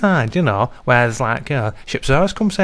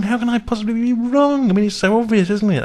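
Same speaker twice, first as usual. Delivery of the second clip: indignant disbelief; 10 semitones higher than usual. Acted. DJ scoffs at being wrong.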